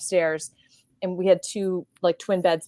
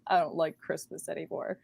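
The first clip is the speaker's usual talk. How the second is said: put-on much softer, deflated little-kid sulk; mock sulky mumble; 3 semitones lower, 8 dB quieter than usual. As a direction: say it like a hurt kid giving up. Sulking brother after falling.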